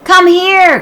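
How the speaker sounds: high, loud call; cried out. Acted. Annie calling Jack to the tree, loud cry. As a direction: call him over urgently. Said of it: "cried Annie".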